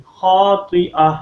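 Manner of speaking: "خَاطِئَةٍ" slow and loud, ending on h; final ha. Shown as a demonstration. Teacher shows the pause rule, "خَاطِئَةٍ" ending in h. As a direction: draw out "خَاطِئَةٍ" and stop on a breathed h. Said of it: "ہا کی sound آئے گی".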